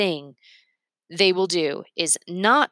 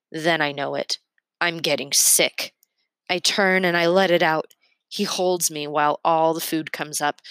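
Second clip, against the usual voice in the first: ordinary delivery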